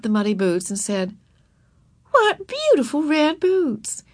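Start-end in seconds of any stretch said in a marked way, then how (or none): 2.1-4.0 high, slow, drawn-out admiration; admiring teacher. A narrator's reading. Teacher picking up muddy boots, admiring. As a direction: marvel for a small child's benefit.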